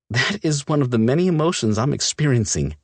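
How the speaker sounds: sarcastic. Acted. Olivia's tense sarcasm.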